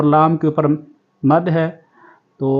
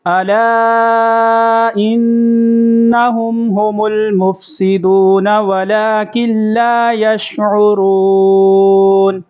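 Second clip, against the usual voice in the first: slow, higher melodic tajweed chant; Quran recitation; 5 semitones higher, 4 dB louder, much slower than usual. Shown as a demonstration. Teacher recites the verse for students to repeat, in melodic chant. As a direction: recite it melodiously, stretching the long vowels.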